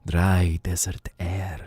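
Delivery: slow, low-key exotic merchant voice; unhurried desert trader offering treasures; mysterious desert merchant. Acted. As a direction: lure the customer in, slowly and softly.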